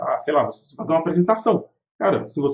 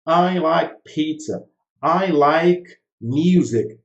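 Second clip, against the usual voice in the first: slow drill sentences; 3 dB louder, much slower than usual. Shown as a demonstration. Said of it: "Então".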